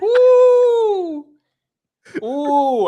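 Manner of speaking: high, loud "woo"; excited whoop. Real, not acted. Keen to go to India, excited whoop.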